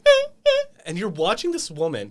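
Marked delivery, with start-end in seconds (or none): 0.0-0.6 short ah ah yelps, much higher and louder; squeaky startled ah sounds. Retold or imitated. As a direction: yelp in mock alarm. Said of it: "And I'm just like".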